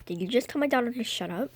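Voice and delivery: ordinary delivery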